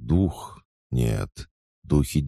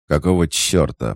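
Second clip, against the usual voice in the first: louder, higher angry outburst; growled; 4 semitones higher, 6 dB louder than usual. A narrator's reading. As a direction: growl it in irritated alarm. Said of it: "прорычал Фома".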